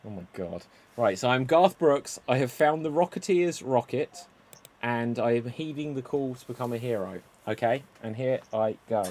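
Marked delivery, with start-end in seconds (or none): none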